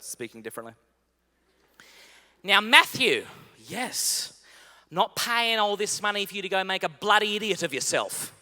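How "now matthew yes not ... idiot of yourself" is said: a hint of mum's Irish-style accent, louder; lightly voiced stern mother's accent. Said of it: "this is her accent".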